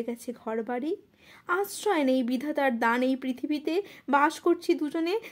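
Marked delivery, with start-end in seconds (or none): none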